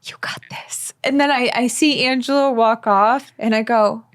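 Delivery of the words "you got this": soft backstage whisper; whispered encouragement. Retold or imitated. Retelling her friend's backstage encouragement, a hushed whisper. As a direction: breathe it like a secret pep talk.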